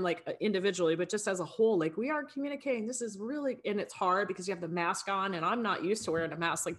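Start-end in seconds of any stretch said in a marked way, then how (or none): 2.0-3.6 high-pitched mock team scolding; retold yelling. Coach recalling yelling at the team as a whole, mock scolding. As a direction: imitate a frustrated coach's yell without the volume. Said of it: "not yelling at them".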